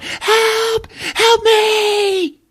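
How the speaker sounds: high, shrill-pitched cry for help from outside; retold scream for help. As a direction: call for help as if far away and desperate. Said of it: "we hear Cassie scream out".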